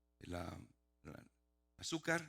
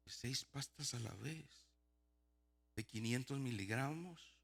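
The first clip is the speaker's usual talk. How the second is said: slow, softer, questioning echo; quiet incredulity; 4 dB quieter, slower than usual. Retold or imitated. Patient questioning the huge dose, softly incredulous. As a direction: repeat it slowly in hushed disbelief.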